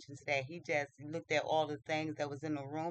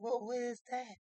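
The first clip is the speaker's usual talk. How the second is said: higher, wondering question; puzzled surprise; 6 semitones higher than usual. Acted. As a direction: act out a prince puzzled by a sound. Prince startled by a chirp.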